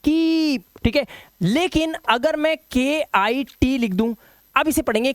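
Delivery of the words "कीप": कीप high and held, "keeeep"; stretched long vowel. Shown as a demonstration. Teacher correcting the short misreading, stretched long vowel. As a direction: hold the ee of कीप long on a raised pitch. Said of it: "long vowel sound होता है".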